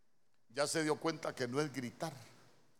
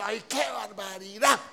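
loud, high outburst of mock outrage; exasperated scolding; 5 semitones higher, 8 dB louder than usual. Spouse hears the errand was forgotten, exasperated scolding. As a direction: scold a forgetful spouse at full voice.

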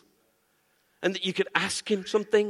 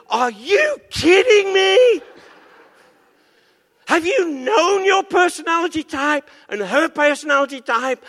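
God incredulous; 8 semitones higher, 7 dB louder than usual. Acted. God shouts down the man's prayer for the woman. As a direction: cry out in disbelief and overrule. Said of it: "God's saying".

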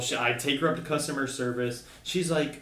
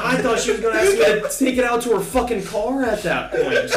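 laughing; 9 semitones higher, 6 dB louder than usual. Real, not acted. Laughing at the end of the story.

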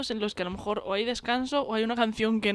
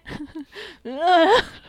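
high laugh; 8 semitones higher, 7 dB louder than usual. Real, not acted. After acting drunk exchange.

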